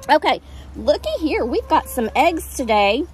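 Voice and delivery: ordinary delivery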